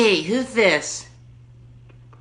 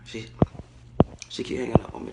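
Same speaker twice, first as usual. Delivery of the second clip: hushed, breathy words away from the phone; whispered aside; 6 semitones lower, 8 dB quieter, much faster than usual. Real, not acted. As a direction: mutter it so she can't hear.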